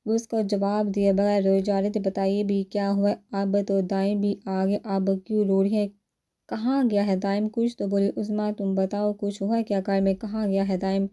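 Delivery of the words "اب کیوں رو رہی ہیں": plain reading voice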